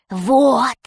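hissing rat villain, drawn-out word; Крыс. A narrator's reading. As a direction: hiss it out as a rat pirate. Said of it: "прошипел крыс". (шипящим голосом крысы)

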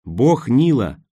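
slow, drawn-out triumphant exclamation; ringing exclamation. A narrator's reading. Roen finding the river god, ringing with excitement. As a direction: let each word ring out slowly. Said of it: "зазвенел её голос".

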